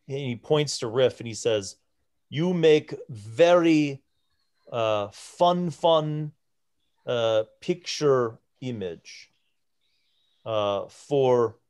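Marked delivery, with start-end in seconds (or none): 2.3-11.6 slow giant groping for words; halting pidgin, hunting for each word; broken Common. Acted. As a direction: giant struggling with the language. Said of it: "in broken common".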